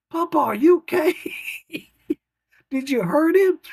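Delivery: put-on worried little girl. Granddaughter to grandpa after he dives on the fish, worried child. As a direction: cry out in worry for Papa.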